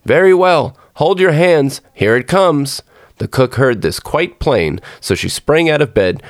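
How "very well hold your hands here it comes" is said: high, ringing storybook call; narrator's raised-voice holler; calling out loud. A narrator's reading. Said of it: "called out as loud as he could".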